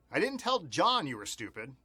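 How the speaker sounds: single out John with firm emphasis; stress on John. Shown as a demonstration. Showing learners how meaning changes, stress on John. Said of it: "change the word that we emphasize".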